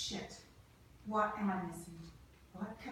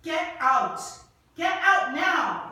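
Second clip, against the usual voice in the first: furious, slow, pitched up yelling; furious shout; 9 semitones higher, 14 dB louder, much slower than usual. Acted. Eve ordering James out of her lab, furious shout. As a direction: shout him out of the lab, louder each time.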